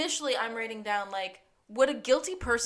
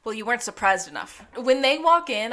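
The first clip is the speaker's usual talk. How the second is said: ordinary talk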